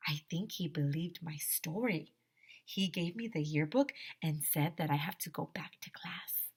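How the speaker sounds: whispered aside to the listeners; secretive story whisper. A narrator's reading. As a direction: confide it in a whisper. Narrator aside, voiceless.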